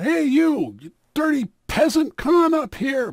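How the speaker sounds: bully him across the car; rough taunt. Acted. Burly card player jeers at the peasant, loud.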